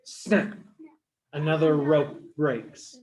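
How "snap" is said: plain reading voice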